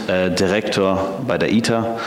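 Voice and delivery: normal talking voice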